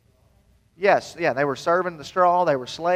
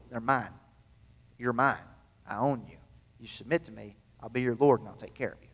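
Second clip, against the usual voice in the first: God's tender claim; 5 semitones lower, 6 dB quieter than usual. Acted. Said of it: "God's calling his nation out and saying".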